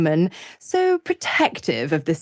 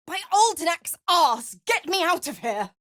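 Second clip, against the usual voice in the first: exasperated; 10 semitones higher than usual. Acted. Trapped character curses, demands release.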